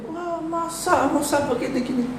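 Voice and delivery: mocking complaint. Aaron and Miriam complaining.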